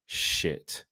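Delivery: unvoiced, soft muttered curse; whispered curse. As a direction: breathe it out as the mistake sinks in. Voicing Maguire's realization.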